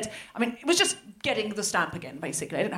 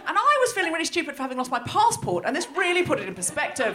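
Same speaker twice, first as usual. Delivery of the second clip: usual speaking voice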